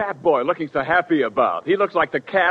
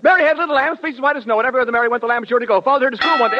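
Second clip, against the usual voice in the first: rushed recital; 7 semitones higher, much faster than usual. Acted. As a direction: race through it to win. Speed-reading contest.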